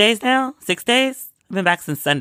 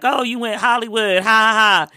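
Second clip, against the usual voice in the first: mock-teasing, higher voice with loud fake laugh; 6 semitones higher, 3 dB louder than usual. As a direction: imitate yourself ribbing a friend, pitched up. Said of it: "I always tease them".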